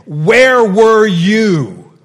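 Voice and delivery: slow, booming, angry shout of "Where were you?"; thundering rebuke. Shown as a demonstration. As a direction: boom "Where were you?" as a furious God. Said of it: "Or did God say".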